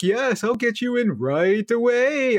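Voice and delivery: high, slow, exaggerated helpfulness; mock-obliging, stretched-out promise; condescending. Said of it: "totally being condescending".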